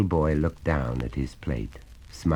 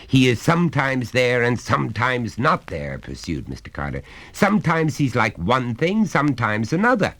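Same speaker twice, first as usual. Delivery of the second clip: higher, louder lecturing father; stern father; 10 semitones higher, 7 dB louder than usual. Acted. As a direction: lay down the law to the boy.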